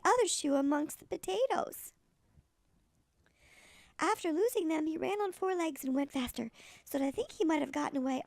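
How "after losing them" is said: ordinary delivery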